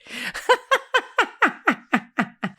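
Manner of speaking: high burst of astonished laughter; delighted laugh. Real, not acted. Laughing at being read accurately.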